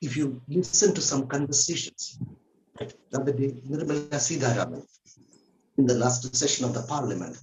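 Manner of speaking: usual speaking voice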